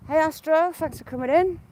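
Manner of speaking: high, bright hello; cheery greeting. Real, not acted. Welcoming Astro to the live stream, bright and high. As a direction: call out a happy welcome.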